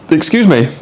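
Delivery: bright, polite little apology after burping. Real, not acted. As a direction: excuse yourself politely. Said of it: "I pardoned myself".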